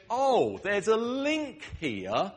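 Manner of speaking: loud, high burst of discovery; sudden realization. Acted. Listener suddenly seeing the link in a pun, loud realization. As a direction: exclaim as the connection clicks. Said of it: "it makes you think".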